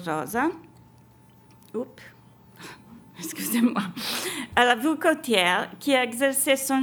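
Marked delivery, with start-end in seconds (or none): 3.2-3.8 whispered. Real, not acted. Whispered apology after a slip.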